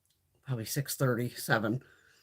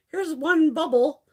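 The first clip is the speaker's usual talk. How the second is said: mocking packer; 6 semitones higher, 4 dB louder than usual. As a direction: drag it out like you couldn't care less.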